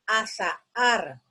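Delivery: "azahar" drawn out slowly, a-za-har, each vowel clear; slow syllables. Shown as a demonstration. Teacher to class, reading "azahar" slowly to show the hiatus. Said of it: "Luego está".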